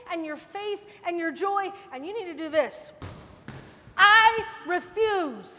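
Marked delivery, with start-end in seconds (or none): 3.9-5.5 slow, loud I refuse; defiant refusal. Retold or imitated. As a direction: shout your refusal, stretched out. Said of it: "what I kept saying in my car".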